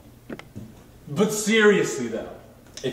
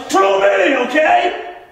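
loud; 11 semitones higher, 7 dB louder, slower than usual. Acted. Insistent sales pitch.

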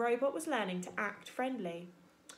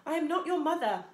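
a lightly louder, even robot voice; 6 semitones higher, 5 dB louder than usual. Acted. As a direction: speak like a calm, patient robot. Robot character voice.

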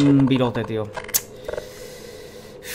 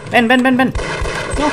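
shouting; 10 semitones higher, 5 dB louder than usual. Real, not acted. Calling enemies during fight.

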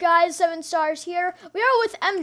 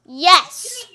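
ordinary talk

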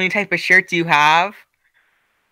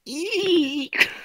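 squeal laugh; 10 semitones higher, 4 dB quieter than usual. Real, not acted. Laughing after weird noise.

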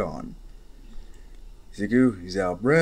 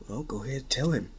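plain reading voice

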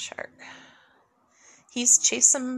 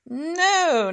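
ordinary talk, nothing marked

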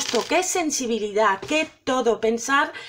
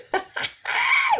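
squealing giggle, very high; a real burst of laughter; delighted high laugh. Real, not acted. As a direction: let out a shrieking giggle. Laughs at the Siri earrings.

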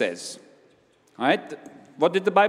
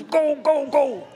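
much higher, mock urging; pushy driving call; 8 semitones higher, slower than usual. Someone behind us forcing us on, mock pushy call. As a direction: chivvy them along, hammed-up. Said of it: "He doesn't stand behind us and says".

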